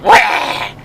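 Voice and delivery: high, loud imitated baby wail; mock wail. Dad copying the baby, a high loud mock wail. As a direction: wail right back at the baby. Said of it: "So I go like".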